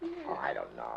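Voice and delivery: low, soft hemming and hawing; feigned reluctance. Acted. Comedian coyly answering plea to do the show, feigned reluctance. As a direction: hesitate as if needing coaxing.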